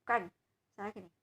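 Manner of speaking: ordinary talk, nothing marked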